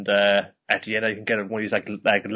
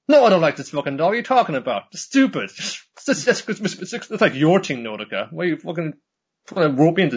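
mock angry rabbit; 6 semitones higher than usual. Rabbit denying he likes the doll, high and angry. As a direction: snap back like an embarrassed cartoon rabbit.

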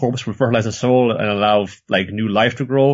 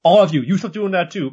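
ordinary talk, nothing marked

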